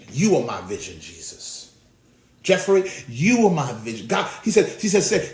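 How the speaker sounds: normal talking voice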